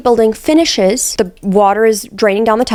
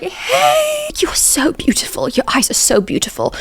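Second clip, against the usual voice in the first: awkward flattery; 9 semitones higher, 3 dB quieter than usual. Retold or imitated. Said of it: "Ollie comes in, like, awkwardly".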